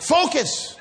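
high, punched-out coach's command; mock drill her like a coach; urgent coaching shout. Coach to wife in contractions, urgent shout.

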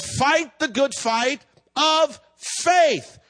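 "faith" set apart and drawn out, falling at the end; emphatic correction. Shown as a demonstration. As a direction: preach it very slowly, landing hard on "faith". True verse set against rejected versions.

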